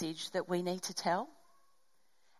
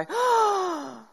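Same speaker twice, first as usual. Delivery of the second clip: sharp high gasp of alarm; startled gasp on seeing police lights; shocked gasp; 11 semitones higher, 6 dB louder than usual. Retold or imitated. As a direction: suck in air at the flashing lights. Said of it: "that horrible feeling when you go".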